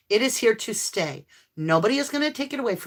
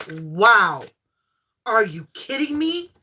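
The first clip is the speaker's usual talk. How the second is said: amazed disbelief; 6 dB louder, slower than usual. Real, not acted. Amazed by card spread.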